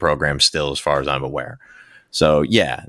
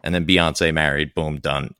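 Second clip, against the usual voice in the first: ordinary delivery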